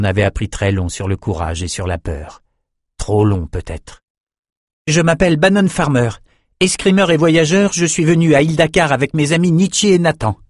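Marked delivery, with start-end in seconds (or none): 4.9-10.4 high-pitched formal self-introduction; Bannon, bold young swordsman. Acted. Bannon presenting himself to general Outros, bold. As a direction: announce yourself boldly, pitched well up.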